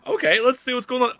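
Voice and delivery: eager curiosity. Retold or imitated. Acting his own curious reaction.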